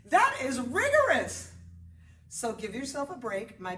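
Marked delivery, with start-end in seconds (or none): 0.1-1.5 high, loud and slow emphasis on "rigorous"; "rigorous" punched high and loud; emphatic. Real, not acted. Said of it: "It's like".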